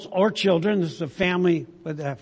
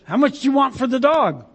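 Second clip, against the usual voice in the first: eager buyer; 7 semitones higher, 3 dB louder than usual. Acted. Joke, buyer asks owner eagerly.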